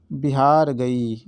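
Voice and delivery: बिहार गई drawn out very slowly; teacher slowly completing the memory trick; slow mnemonic. Shown as a demonstration. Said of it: "आपको ध्यान रखना है".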